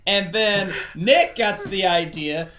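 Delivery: usual speaking voice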